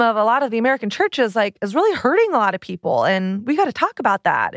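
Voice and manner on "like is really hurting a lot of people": normal talking voice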